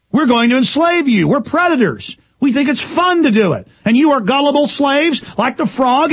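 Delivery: high, emphatic villain's boast; gloating villain. Acted. Scorpion banker taunting his gullible victims, gloating. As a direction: declare your crimes proudly, pitched well up.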